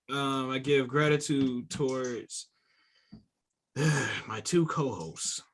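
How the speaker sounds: slow, reluctant gratitude with a sigh; disappointed. Real, not acted. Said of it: "you sound disappointed in us".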